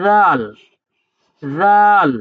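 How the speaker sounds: রাল as soft "dhaal", tongue tip on upper front teeth; touch tongue to teeth tips for the first sound of রাল; soft dental zal. Shown as a demonstration. Teacher showing second soft letter, রাল with tongue on teeth. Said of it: "সামনে উপরে দাঁতের আগার সঙ্গে লাগিয়ে".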